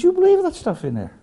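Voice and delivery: high, squeaky mock disbelief; incredulous high voice. Preacher voicing a scoffing pilot, squeaky and incredulous. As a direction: pitch it way up like a scoffing old friend.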